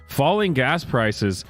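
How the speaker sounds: ordinary delivery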